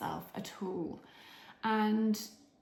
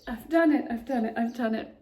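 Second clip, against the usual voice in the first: loud triumphant cry; excited; 2 semitones higher, 8 dB louder than usual. Real, not acted. After finishing her radio slot, loud triumphant joy. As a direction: burst out with it, real elation.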